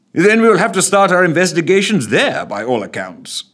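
pleased with proceedings; amused PM. Acted.

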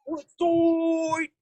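high, loud hype call; west side shout-out. Pretend. Friend shouts out the west side in a high, loud hype call.

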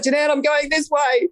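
high call down the corridor. Retold or imitated. Being wheeled away, calling to Jeanette down the corridor, high call. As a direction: call out to a friend far down the hall.

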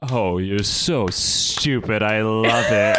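slow, gloating villain voice; Sinister. Retold or imitated. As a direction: relish the insult, drawing out each word. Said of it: "Sinister's just laughing at him".

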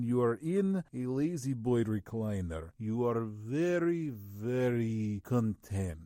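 slow, stretched-out Russian accent; lazy, contented Russian drawl, words drawn out. Shown as a demonstration. Actor demonstrating his La-Z-Boy recliner trick, slow Russian accent. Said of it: "how he gets into a Russian accent".

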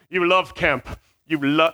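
lightly voiced Jedi suggestion; a hint of mock-mystical Jedi; Jedi mind trick. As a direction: plant the idea like a Jedi. Acting Jedi mind trick in robes.

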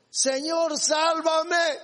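high-pitched cry for rescue; acted cry, pitched well up. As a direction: call out urgently to be saved. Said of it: "gritando, diciendo".

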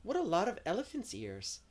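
high, loud burst of delight; excited exclamation. A narrator's reading. Diana spotting elephant's ears along the path, exclaiming. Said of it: "exclaimed Diana".

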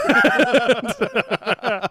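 high, loud mock toast; hearty cheer to the crowd. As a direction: raise a glass and bellow it.

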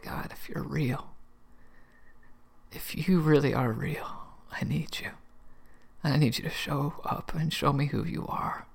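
hushed, pleading words to God, half whispered; a soft retold cry for help; retold desperate prayer. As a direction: plead quietly, breaking at the edges. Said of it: "And so I cried out".

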